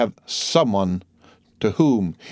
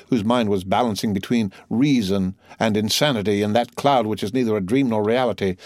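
ordinary delivery

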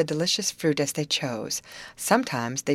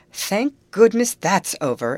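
higher, louder burst of relief; raised voice, relief after a scare; relieved exclamation; 5 semitones higher, 6 dB louder than usual. A narrator's reading. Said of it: "exclaimed Grace with a sigh of relief".

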